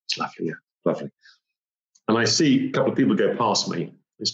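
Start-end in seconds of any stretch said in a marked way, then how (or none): 0.1-1.1 lower, softer, fast drowsy murmur; drowsy, fading. Retold or imitated. Climber without oxygen drifts off, murmuring drowsily. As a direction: drift off as you speak. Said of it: "very calm".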